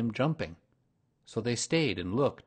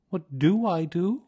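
higher, puzzled bear voice; Pooh voice; 9 semitones higher, 8 dB louder than usual. A narrator's reading. Pooh asks Rabbit what to do, higher and puzzled. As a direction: ask it like a simple, eager bear.

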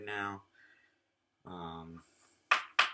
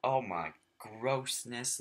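disgusted; 4 semitones higher, 6 dB louder, much slower than usual. Real, not acted. Showing gross old motel bed.

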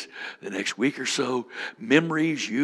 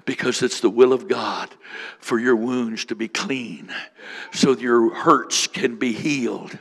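ordinary talk, nothing marked

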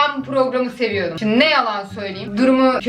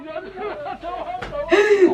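helpless high laugh; laughing hard; 5 semitones higher than usual. Real, not acted. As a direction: burst out laughing. Reacting to funny clip. (kahkahayla gülme)

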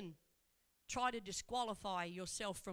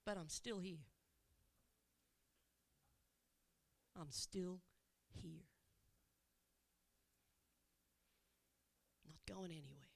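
much softer and lower, fading to a hush; hushed reassurance; 6 semitones lower, 8 dB quieter than usual. Acted. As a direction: promise softly as the voice falls away. Repeats Jesus promise, fading.

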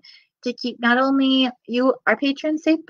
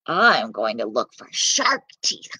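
lower, slower growly voice; low piglet voice; 12 semitones lower than usual. Acted. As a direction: drop low for Pop. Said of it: "says Pop".

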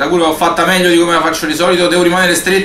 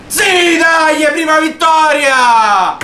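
victory shout; 10 semitones higher, much slower than usual. Real, not acted. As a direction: shout out the first win. Winning the race.

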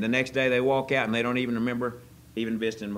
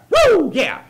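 high, loud whooping fake joy; mock delight; 13 dB louder than usual. Pastor playing a happy churchgoer with a flat tire, loud mock whoop. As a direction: cheer the flat tire like great news.

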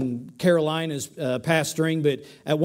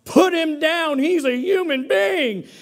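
high, loud indignant shout; angry churchgoer shouting in defense of the man; screaming out; 8 semitones higher, 3 dB louder than usual. Retold or imitated. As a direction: yell in protest across the room. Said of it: "began to scream out".

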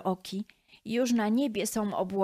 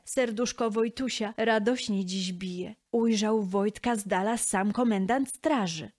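ordinary delivery

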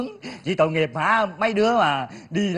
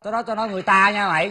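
ordinary delivery

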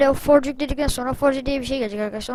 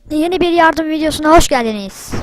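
loud intro greeting; 4 semitones higher, 10 dB louder than usual. Acted.

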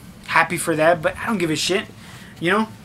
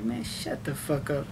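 muttered brush-off; 5 semitones lower, 10 dB quieter than usual. Retold or imitated. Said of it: "I'm like".

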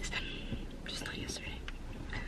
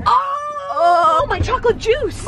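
dismayed cry; 9 semitones higher than usual. Real, not acted. Spilled drink dismay.

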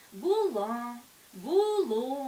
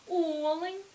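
Олень, stress on the first syllable О, said louder; correct stress; 5 semitones higher, 7 dB louder than usual. Shown as a demonstration. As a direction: punch the opening О of олень. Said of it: "наголос падає на перший склад". (наголос на першому складі: Олень)

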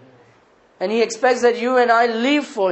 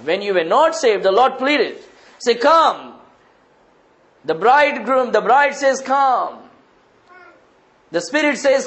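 high, raised preacher's call; fervent preaching; 4 semitones higher than usual. Acted. Preacher calling the unsaved to come, fervent and raised. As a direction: call out the invitation with force. Preaches the invitation at full force.

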